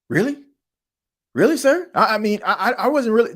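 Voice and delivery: high, rising "really, sir?"; excited disbelief. Pretend. Player stunned at being named starter, high and excited. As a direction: leap up in pitch, stunned and thrilled.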